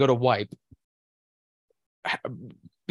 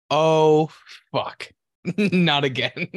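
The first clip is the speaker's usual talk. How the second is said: half-laughing, louder mock dismay; 4 semitones higher, 4 dB louder, much slower than usual. Retelling the fart that hit his underwear, laughing dismay. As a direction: groan it out through laughter.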